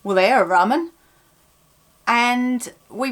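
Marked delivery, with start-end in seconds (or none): 0.0-0.9 knowing Suffolk remark; Suffolk accent. Shown as a demonstration. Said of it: "somebody would say".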